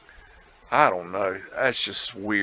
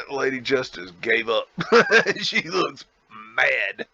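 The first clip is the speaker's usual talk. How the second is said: real laughter breaking through the words; amused, laughing mid-sentence. Real, not acted. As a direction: crack up while talking. Amused by statue of Lady Justice.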